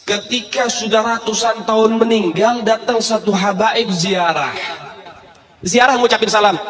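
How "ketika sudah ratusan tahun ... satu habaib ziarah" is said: slow, drawn-out retelling. Acted. Preacher slowing down for the crowd. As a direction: stretch each word out slowly. Preacher repeats line slowly.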